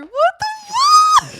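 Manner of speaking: startled shriek. Pretend. Startled by the imagined ghost.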